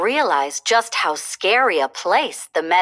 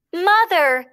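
high, frightened call; lost fawn calling out; crying out; 9 semitones higher, 3 dB louder than usual. A narrator's reading. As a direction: cry out for mother. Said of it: "he cried".

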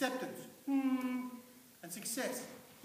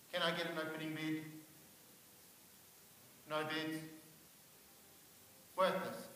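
quiet, lower deflated auctioneer call; dejected; 4 semitones lower, 7 dB quieter than usual. Acted. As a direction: ask for a bid expecting none. Auctioning himself, no bids.

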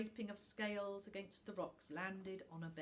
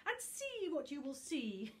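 loud, sneering villain voice; the book sneers at little Tuft; 8 semitones higher, 7 dB louder than usual. Acted. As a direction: taunt him with a sneer.

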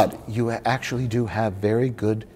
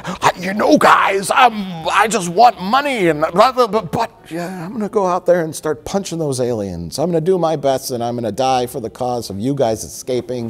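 Danny DeVito voice; 6 semitones higher than usual. Retold or imitated. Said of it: "if Danny DeVito had said".

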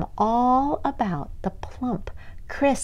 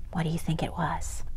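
whisper; 3 semitones lower, 10 dB quieter, much faster than usual. A narrator's reading. Narrator whispers question to listeners.

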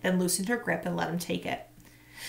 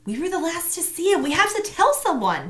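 put-on worried girl; 10 semitones higher, 4 dB louder than usual. A narrator's reading. Tamaya pleads about Chad.

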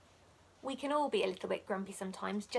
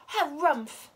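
loud, higher huffing harumph; grumpy harumph; 5 semitones higher, 9 dB louder than usual. A narrator's reading. Pom Pom hating the noisy birds, loud harumph. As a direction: huff at the sunny day. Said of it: "said Pom Pom".

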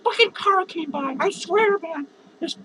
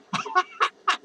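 high-pitched cackle. Real, not acted. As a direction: crack up in a high giggle. Cracks up after swearing.

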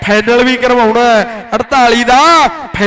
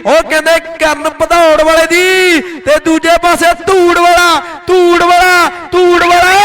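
excited; 5 semitones higher than usual. Acted. Commentator hypes a player.

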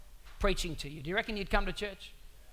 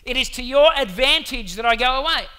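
bold declaration; 5 semitones higher, 14 dB louder than usual. Retold or imitated. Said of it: "He says".

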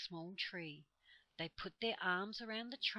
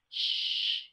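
loud, urgent "shh"; alarmed shush; 13 dB louder than usual. A narrator's reading. Squirrel silences the noisy children, an alarmed loud shush. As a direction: hush them sharply in alarm. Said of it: "said the squirrel in alarm".

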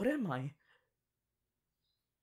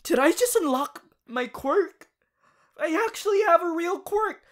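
loud, much higher thrilled exclaiming; excited; 11 semitones higher, 8 dB louder than usual. Acted. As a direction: burst out with delight. Thrilled at unlocking a quirk.

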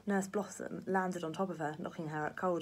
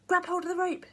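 high, loud urgent shout; 13 semitones higher, 8 dB louder than usual. A narrator's reading. Grandpa shouts to Jack over the ringing bell, loud and high. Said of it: "shouted the old man".

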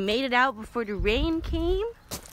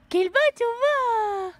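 very high, loud Kelba tova; lavish it on the dog; high, loud gushing praise; 14 semitones higher, 7 dB louder, slower than usual. Real, not acted.

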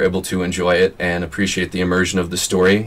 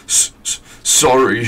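stammering, sobbing apology; sobbed; 4 semitones higher, 3 dB louder, slower than usual. Acted. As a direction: choke out the sorry. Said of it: "sobbed Hagrid".

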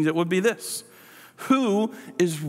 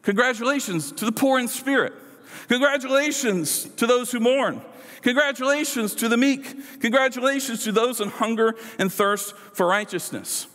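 higher-pitched, emphatic proclamation; celebratory congratulations; 6 semitones higher than usual. Acted. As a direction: hail each group as the lucky winners. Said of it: "Jesus would be saying".

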